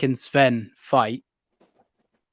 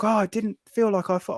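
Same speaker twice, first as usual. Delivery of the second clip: high-pitched retold wonder; pleasantly surprised; 9 semitones higher than usual. Tattooed guy touching lizard first time, surprised. Retells reluctant guy's surprise.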